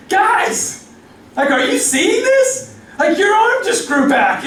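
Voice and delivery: high-pitched amazed cry; freaking out. Retold or imitated. Samaritan to the other lepers, high amazed squeal. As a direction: squeal with disbelief at the healing. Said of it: "the Samaritan's freaking out".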